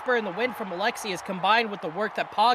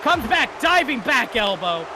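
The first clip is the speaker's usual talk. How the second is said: wrestling commentator; 3 semitones higher than usual. Acted. Live wrestling play-by-play.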